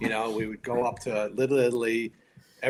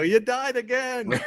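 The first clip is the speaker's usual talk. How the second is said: firemen hollering in mock glee, high and drawn out; high, slow teasing cheer; teasing call-out; 13 semitones higher, slower than usual. Firemen rib their actor colleague about his TV death, teasing call-out.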